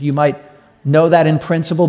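usual speaking voice

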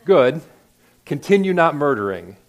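loud mock affirmation. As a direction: cheer them on, half teasing. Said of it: "first I want to affirm that".

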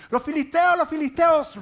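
high, loud cry of warning; urgent high-pitched scream of words; alarmed shout. Acted. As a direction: yell the alarm at full pitch. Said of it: "Dalila empieza a gritar".

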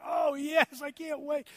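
high-pitched, hammed-up tearful yes; imagine an overcome bride, played for laughs; mock happy weeping. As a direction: gush it out in a mock sob. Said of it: "just bawling and weeping like".

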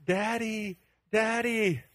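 high, held imitation of a child calling; crying toddler. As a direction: mimic a toddler's cry for dad. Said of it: "she starts flipping out".

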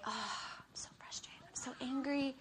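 unvoiced whisper of pent-up anger; whispered frustration. Wife stewing silently at her husband, whispered. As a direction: hiss it under your breath. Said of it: "I'm like".

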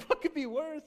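high, loud, astonished question; boy who can't imagine worse; wide-eyed outburst; excited kid. Retold or imitated. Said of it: "And I was like".

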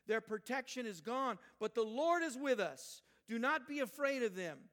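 rally the crowd with full conviction; impassioned scripture reading. Acted. Reading Caleb's speech aloud at full force.

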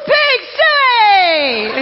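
high, loud Razorback call; hog call. Pretend. Pastor leading the congregation in the Woo Pig Sooie cheer, loud. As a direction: holler it like a stadium cheer. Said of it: "when I say "Woo Pig Sooie," do it with me".

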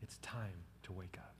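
hushed and tender; gentle whisper. Acted. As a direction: whisper "Little girl" softly. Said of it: "just like he's waking someone up from sleep".

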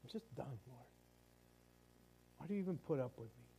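soft, fast, near-whispered despair; hushed despair. Retold or imitated. Desperate soul quietly begging God, soft and fast. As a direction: barely voice it, worn out. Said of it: "it's like".

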